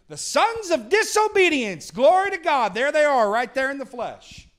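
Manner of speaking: full-throated pulpit cry, fading at the end; preaching shout. Real, not acted. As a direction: lift the roof with it.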